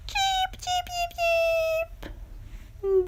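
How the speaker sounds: tiny, very high, soft chirps; soft, squeaky chick peeps from a nest; baby chick cheeps. Acted. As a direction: peep like newborn chicks.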